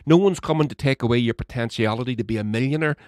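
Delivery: normal talking voice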